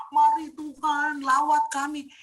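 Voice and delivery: loud, high, slow bossy summons to God; ordering God like a servant; mock commanding prayer. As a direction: order God around, drawn out. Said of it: "Itu kamu penghinaan".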